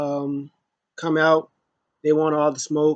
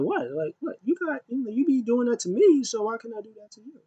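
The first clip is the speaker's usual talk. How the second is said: imitating Sasha; 8 semitones higher than usual. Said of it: "Sasha was like".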